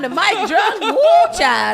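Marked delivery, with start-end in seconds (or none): 0.8-1.4 excited whoop. Real, not acted. Cheer at drunk mic story.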